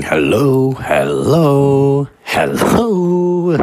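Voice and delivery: sustained, rising test calls to a wall; testing the acoustics. Retold or imitated. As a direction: sing "hello" out to test the room's echo. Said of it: "I'm going".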